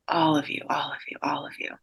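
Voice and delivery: trailing off into a whisper; fading whisper. Real, not acted. Medium echoing the message to family, fading whisper. Medium repeating brother's blessing.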